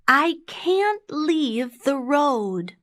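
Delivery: put-on high, slow little girl; high-pitched, careful storybook girl. A narrator's reading. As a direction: refuse slowly in a child's high voice. Girl refuses the wolf.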